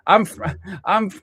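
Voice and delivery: high, loud, laughing fluster; flustered laughing panic. Real, not acted. Streamer laughing at his own setup blunder, high and flustered. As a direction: laugh through the panic at your own mistake. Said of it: "I'm freaking out".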